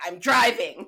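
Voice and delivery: loud re-enacted declaration; retold pissed-off resolve. Traveller waking up furious at six, retold pissed-off resolve. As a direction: announce it like the argument is over. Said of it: "I was just pissed".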